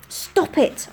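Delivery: urgent, sibilant scolding; hissed. A narrator's reading. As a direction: hiss it sharply. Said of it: "I hissed".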